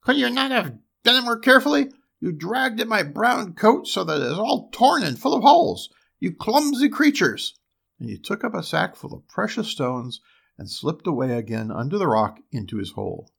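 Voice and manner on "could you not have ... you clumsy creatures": high, loud, scolding dwarf; shrill cry. A narrator's reading. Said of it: "he cried with his shrill voice".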